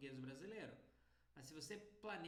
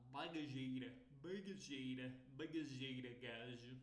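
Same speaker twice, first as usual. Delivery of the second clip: low, loud attempt at a European Portuguese accent; mock European Portuguese accent; European Portuguese accent imitation; 3 semitones lower, 3 dB louder than usual. Shown as a demonstration. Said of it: "imitar o sotaque".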